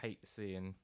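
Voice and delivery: ordinary delivery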